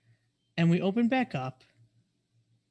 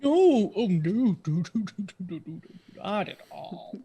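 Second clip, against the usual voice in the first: hasty rock protesting too much; talking rock; 3 semitones higher, 3 dB louder than usual. Acted. Rock denying a cult.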